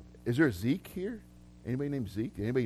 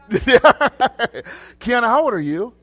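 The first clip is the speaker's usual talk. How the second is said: high, loud, through laughter; real laughing question; 9 semitones higher, 11 dB louder, much slower than usual. Real, not acted. Preacher asks a congregant her age, laughing loudly. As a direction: ask it while cracking up.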